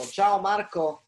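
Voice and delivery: lento e dolce, per il figlio; parlando a un bambino. Real, not acted. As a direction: suggerisci piano al bambino. Padre suggerisce saluto al figlio. (papà che detta il saluto al figlio, dolce e lento)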